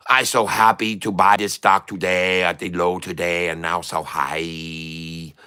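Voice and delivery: put-on broken-English trader in a foreign accent. Plays a foreign trader character.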